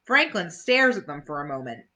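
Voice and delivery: plain reading voice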